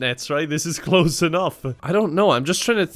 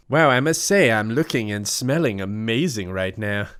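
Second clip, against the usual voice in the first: self-admiring preen, then lower; vain character voice; 5 semitones lower than usual. Acted. Character in the bathroom admiring herself, preening voice. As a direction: purr it admiring yourself.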